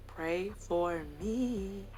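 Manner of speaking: "Pray for me" stretched very slowly; mock-solemn, slow, soft "Pray for me"; drawn-out plea. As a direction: draw out each word of "Pray for me", softly. Slow, deliberate repeat of plea.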